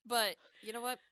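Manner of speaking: ordinary talk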